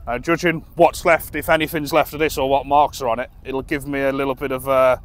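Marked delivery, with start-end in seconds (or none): none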